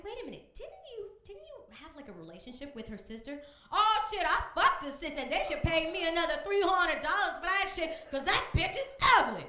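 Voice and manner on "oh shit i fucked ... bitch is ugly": louder, higher boastful guy; loud high brash man voice. Acted. A cocky defendant ranting about the woman who sued him. Tyrone bragging.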